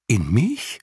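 hoher, verdutzter Ausruf einer alten Mauleselin; fast erschrocken. A narrator's reading. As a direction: erschrocken nachfragen, Stimme springt hoch. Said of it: "rief Jicher fast erschrocken". (Jicher ruft überrascht, höher und lauter)

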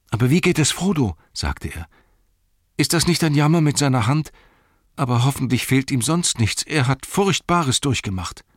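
a concerned hobbit asking after his master; Sam the hobbit. Acted.

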